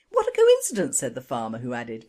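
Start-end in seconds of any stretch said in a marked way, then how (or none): 0.1-1.0 high squeaky delivery. Acted. Farmer's running punchline.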